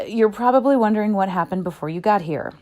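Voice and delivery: usual speaking voice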